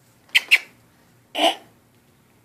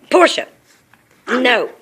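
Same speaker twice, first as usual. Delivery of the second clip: loud sharp call of name; 4 semitones higher, 9 dB louder, slower than usual. Real, not acted. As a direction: call out to stop her. Scolding the other dog.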